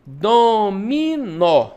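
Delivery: loud, very slow DO-MI-NÓ for "dominó"; syllable separation. Shown as a demonstration. Teacher separating the word for pupils, "dominó" syllable by syllable. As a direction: stretch each syllable of "dominó". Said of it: "Separação de dominó".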